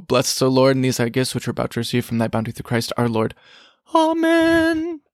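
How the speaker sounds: rattled off fast, words running together; rushed prayer. Shown as a demonstration. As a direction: race through the grace as if late for lunch. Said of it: "Sometimes I say it so fast".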